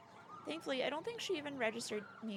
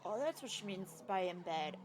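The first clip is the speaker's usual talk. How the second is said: ordinary talk, nothing marked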